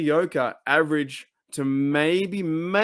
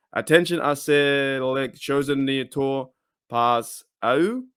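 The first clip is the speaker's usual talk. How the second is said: halting French read in an English speaker's accent; French accent attempt; slower than usual. Shown as a demonstration. Host reads a Steelers France post aloud, attempting a French accent. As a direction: slowly attempt a French accent, feeling out each word. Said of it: "gonna try my best French accent".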